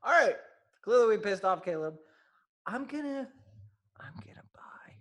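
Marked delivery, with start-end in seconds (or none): 2.6-4.9 whispered mock confession; teasing whisper. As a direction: breathe it out as a tease. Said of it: "No, I'm kidding".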